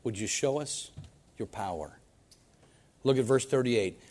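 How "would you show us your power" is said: simple prayer. Shown as a demonstration. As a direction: ask God gently.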